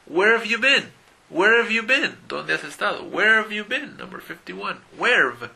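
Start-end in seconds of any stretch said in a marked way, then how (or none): 0.0-4.0 Where have you been said high and loud, full words; Where have you been, much higher and louder; example phrase. Shown as a demonstration. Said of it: "muy común con una frase". 5.0-5.5 where've clipped to weɾv, loud fall; firm model of where've; where've as one syllable; model pronunciation. Shown as a demonstration. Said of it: "Esto es muy común".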